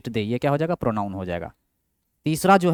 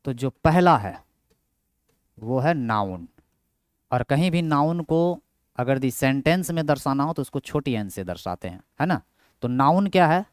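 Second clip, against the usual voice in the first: normal talking voice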